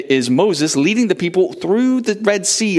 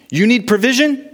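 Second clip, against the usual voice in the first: asking listener; 9 semitones higher than usual. Pretend. Call and answer refrain.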